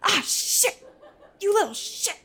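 high, fast angry yelling; furious screams. Acted. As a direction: scream at him in pain and rage. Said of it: "he just screams".

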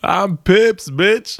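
high, laughing mock yell; laughing mock shout. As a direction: shout it while cracking up. Mocking the drunk friend's line.

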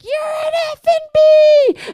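high, loud, slowed demonic shriek; mock demon screech in a tiny woman's body; possessed screaming. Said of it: "that thing jumping up and being like".

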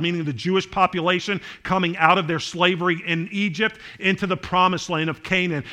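usual speaking voice

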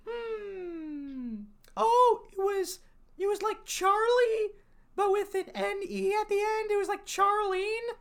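very high, drawn-out character voice; high-voiced curious character. Recalling a wolf's name for a stranger, high-voiced. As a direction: put on a high little voice.

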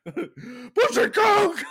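slow, high, loud mock scream laughing. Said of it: "you just start screaming".

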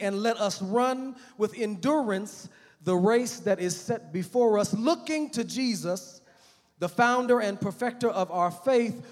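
loud, high, slow, solemn reading; preaching scripture reading. Acted. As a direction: proclaim the verses from the pulpit.

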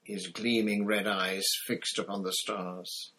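usual speaking voice

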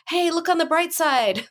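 high, chirpy pep; mock upbeat optimist; forced cheer. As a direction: imitate someone glossing over trouble brightly. Mimicking forced positive thinking.

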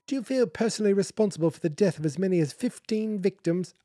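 high-pitched put-on British voice; mock British reporter's question; British accent. Journalist questioning an author, in a put-on British accent. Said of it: "I don't think she had a British accent".

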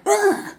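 a high, scornful ugh; disgusted ugh. Retold or imitated. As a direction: scoff in disgust. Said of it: "she gave him a look like".

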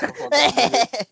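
loud, laughing dismissal; laughing outrage. Retold or imitated. Reacting to the album title, loud laughing dismissal. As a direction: laugh it off in disgust. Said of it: "I was like".

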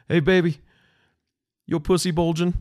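hammed-up angry husband; mad husband. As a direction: bark the question at his wife. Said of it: "he was so mad".

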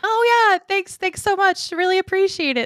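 high, loud, breezy fake-cheerful thanks; mock polite thanks. Mimicking her friend's unmoved reply, high chirpy thanks. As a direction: chirp it out high and bright like nothing touched you. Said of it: "Straight don't a wall".

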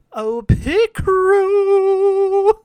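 swooning excitement. Real, not acted. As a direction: stretch out "pit crew" in thrilled delight. Swooning over the pit crew.

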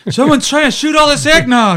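high, loud panicked movie voice; The Jerk quote. Retold or imitated. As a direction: shout it like a panicked comic hero. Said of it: "like in The Jerk".

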